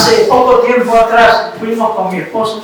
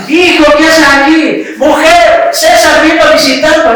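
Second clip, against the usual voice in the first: loud, higher call into the house, announcing a visitor; 6 semitones higher, 4 dB louder than usual. Retold or imitated. Relative at the window calls out to the family, loud and high.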